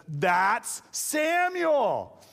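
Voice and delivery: high, very drawn-out exclamation; mock astonished cry. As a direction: cry out the name slowly, hammed-up. Saul recognizes the summoned spirit.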